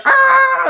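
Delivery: very high shriek; imitated scream. Imitating her screaming back at him, high scream. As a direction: shriek back in mock terror. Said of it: "and then Shay's screaming".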